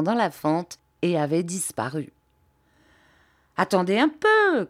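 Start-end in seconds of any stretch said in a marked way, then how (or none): 3.6-4.6 high, loud call from afar. A narrator's reading. Sir Hassel calling out to the others from the crack. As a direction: call back from inside the crack. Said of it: "cria-t-il".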